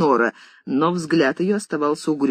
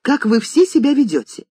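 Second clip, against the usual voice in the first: high and louder, indignant; furious mistress; 6 semitones higher, 4 dB louder than usual. A narrator's reading. Mistress scolding the maids' behaviour, indignant. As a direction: let the outrage spill over.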